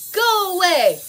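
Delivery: loud stern bark; stern shout. Acted. Grumpy bear shouting at the goslings, stern loud. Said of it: "Bruce was very stern".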